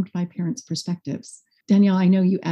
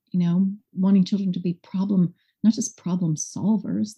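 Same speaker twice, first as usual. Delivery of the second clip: ordinary talk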